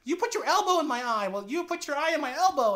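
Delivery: high, loud tit-for-tat squabble; mock bickering. Partners both triggered over small things, mock bickering.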